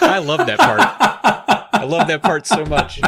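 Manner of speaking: high laughing gush; laughing delight. Real, not acted. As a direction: giggle through it.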